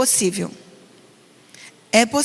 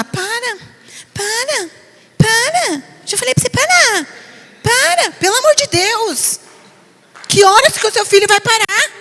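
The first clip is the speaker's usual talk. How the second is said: nagging mother; 8 semitones higher than usual. Retold or imitated. Pastor mocking a mother who repeats para to her child, high pleading nag. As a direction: imitate an exasperated mother begging her child to stop. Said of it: "Eu fico muito mal de ver uma mãe falar".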